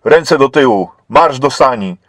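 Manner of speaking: loud, curt orders; barked commands. A narrator's reading. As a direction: command like an officer arresting someone. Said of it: "rozkazał jej".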